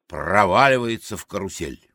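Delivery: normal talking voice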